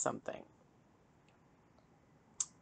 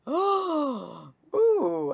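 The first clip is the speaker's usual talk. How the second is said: gleeful gasp; 11 semitones higher, 5 dB louder than usual. Real, not acted. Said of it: "I'm feeling evil".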